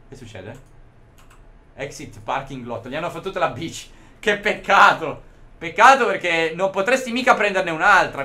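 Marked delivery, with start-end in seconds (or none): 4.2-8.2 hammed-up sorrow over the stolen bike; mock pity. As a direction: drag out "Che peccato" with fake sympathy.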